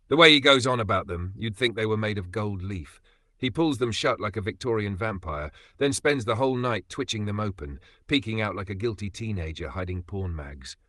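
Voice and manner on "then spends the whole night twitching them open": ordinary talk, nothing marked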